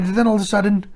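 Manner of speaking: ordinary talk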